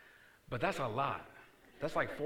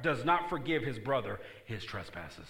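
ordinary talk, nothing marked

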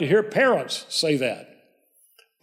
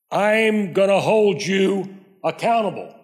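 threatening tone; 2 semitones higher than usual. Shown as a demonstration. Preacher demonstrating the phrase that makes people defensive, threatening. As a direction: lay it down like a threat, word by word. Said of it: "used in that tone of voice. It's like a threat".

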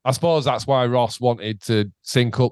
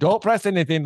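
urgent, higher-pitched order; re-enacted alarm, voice raised in pitch; panicked; 6 semitones higher than usual. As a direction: plead for hands off in mock panic. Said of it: "a fucking moment of panic".

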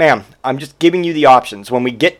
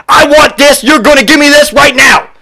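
high, loud bossy demand; mock demanding customer; 10 semitones higher, 6 dB louder than usual. Entitled customer demanding the clerk, high and loud.

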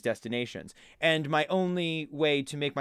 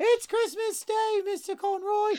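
put-on very high, slow squeaky kid voice; high-pitched little boy answering up to the window; child's voice; slower than usual. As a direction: pipe up like a Victorian street urchin. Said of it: "And they go".

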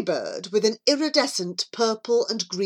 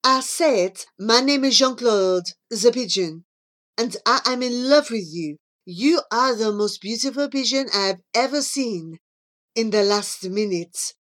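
put-on amorous pigeon with a French accent; Jean-Claude. Jean-Claude repeating his love to Charlie, ardent French suitor. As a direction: insist like a French suitor wooing.

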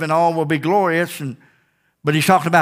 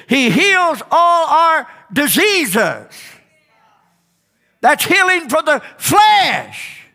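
preaching; 9 semitones higher than usual. Acted. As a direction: build to a shouted climax. Climax of sermon.

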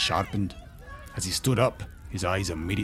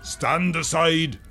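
high, drawn-out roared command; big commanding shout; 10 semitones higher, 4 dB louder, much slower than usual. A narrator's reading. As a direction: roar it slowly to clear a crowd. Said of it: "Broughton roared".

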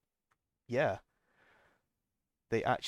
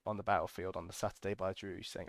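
usual speaking voice

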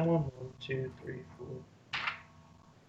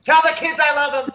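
dramatic cry; 11 semitones higher, 4 dB louder than usual. Pretend. Mock farewell cry to wife.

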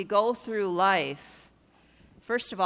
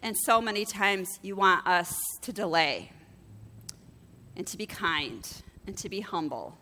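prayer. Real, not acted. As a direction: lead a group gently in prayer. Leads closing prayer.

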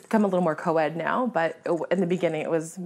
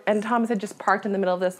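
usual speaking voice

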